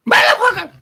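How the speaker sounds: loud, very high falsetto swear; imitating cursing mother. Imitating mother having a bad day, loud falsetto curse. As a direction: squeal the curse like sore mom. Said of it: "she was having a bad day".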